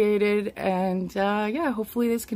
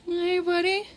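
much higher voice for a pet; pet talk; 11 semitones higher than usual. Real, not acted. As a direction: greet your pet in a high coo. Greets uncomfortable pet in hand.

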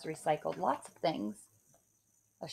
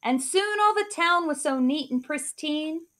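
put-on lively rhyming read-aloud for kids; story narration; 4 semitones higher, 8 dB louder than usual. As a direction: read it like a bedtime picture book. Reads picture book aloud.

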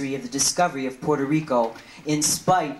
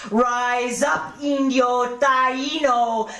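higher, slow, rising call to rise; rallying call; 10 semitones higher, much slower than usual. Acted. Poet calling the Taíno people to rise, higher rallying call. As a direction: lift the voice like a summons.

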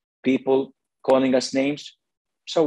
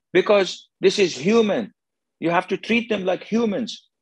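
higher, earnest protest; insistent critic; 6 semitones higher than usual. Acted. Woman arguing with the sheikh over handshakes, insistent. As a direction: imitate a critic pressing the point.

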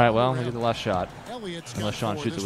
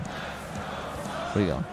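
"Where are you going?" whispered very slowly and a bit softer; slow teasing whisper; drawn-out whisper; 6 semitones lower, 6 dB quieter, much slower than usual. Pretend.

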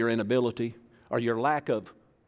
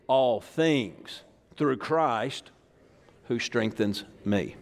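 slow verse; 2 semitones lower, much slower than usual. Real, not acted. Preacher slows key verse.

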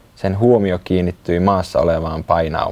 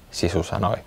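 usual speaking voice

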